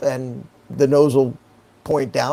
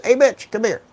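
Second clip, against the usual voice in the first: higher-pitched call to a pilot friend; mild retold yell; 7 semitones higher than usual. Pilot calling his friend over from the plane, mild retold yell. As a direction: retell his yell without much extra volume. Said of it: "he yells".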